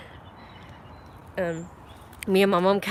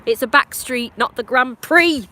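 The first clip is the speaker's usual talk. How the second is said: real angry yell at a speeding driver; angry shout; 5 semitones higher, 6 dB louder than usual. Real, not acted. Yelling at a driver speeding down the back street, angry shout. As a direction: bellow it at the car that sped past. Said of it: "That stupid guy ... pissed me off".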